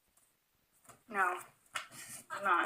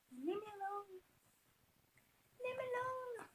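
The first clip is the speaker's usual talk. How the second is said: voicing the pet; 11 semitones higher, 13 dB quieter, slower than usual.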